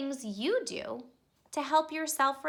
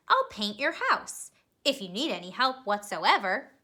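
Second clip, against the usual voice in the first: put-on lively storybook narration for kids; expressive read-aloud for young listeners; reading to children; 3 semitones lower, 3 dB louder than usual. A narrator's reading. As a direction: read it like a bedtime picture book. Reads picture book to children.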